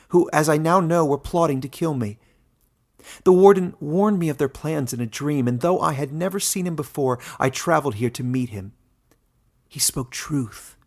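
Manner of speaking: Glista, earnest pleading. A narrator's reading. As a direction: beg him to trust the warden.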